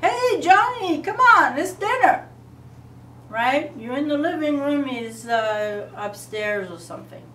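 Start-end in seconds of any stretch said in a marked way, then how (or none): 0.0-2.3 loud, high yell across the house; calling out. Retold or imitated. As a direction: holler for the kid to come eat. Parent yelling for child.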